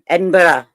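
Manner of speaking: Edinburgh said with a careful burra ending; corrected Scottish pronunciation. Shown as a demonstration. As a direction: fix the slip, say Edinburgh the Scottish way. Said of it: "There you go".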